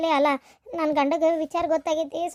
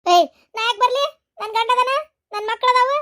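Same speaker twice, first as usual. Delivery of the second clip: high, loud outraged refusal; upset protest; 7 semitones higher, 4 dB louder than usual. Acted. Wife loudly refusing to leave her husband and children.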